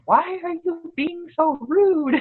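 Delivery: high, slow exasperated demand; retelling her own frustrated snap at the child; irritated parent. Retold or imitated. Parent losing patience with her child, exasperated high voice. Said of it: "I was getting irritated 'cause I'm like".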